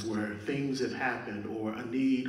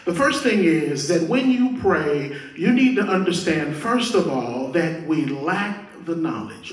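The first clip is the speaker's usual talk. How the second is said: pulpit preaching, punching the key phrases; emphatic preaching; 3 semitones higher, 4 dB louder than usual. Real, not acted. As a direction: drive the point home to the congregation. Preaches at rising force.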